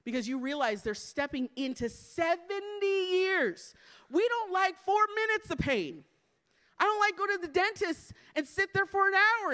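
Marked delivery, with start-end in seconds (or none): 1.9-9.5 high, incredulous preaching cry; emphatic disbelief. Real, not acted. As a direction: raise the pitch to drive home how long it is. Preacher exclaims over seventy years.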